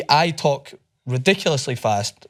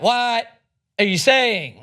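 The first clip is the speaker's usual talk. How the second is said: exaggerated bewildered question; baffled server; 8 semitones higher, slower than usual. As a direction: imitate a totally lost American cashier. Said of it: "she's like".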